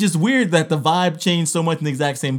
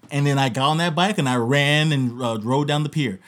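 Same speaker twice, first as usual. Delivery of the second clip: ordinary delivery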